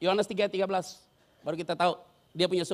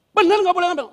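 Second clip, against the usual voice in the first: pushy Satan; 10 semitones higher, 12 dB louder than usual. Acted. Satan presses God again.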